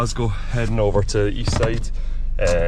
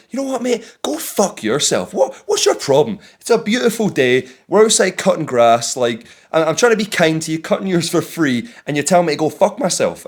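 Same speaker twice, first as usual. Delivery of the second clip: replaying a heated retort, pitched up and raised; retold angry comeback; 8 semitones higher than usual. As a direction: snap back at the rude neighbour, hammed up. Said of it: "there's only so far I can be pushed".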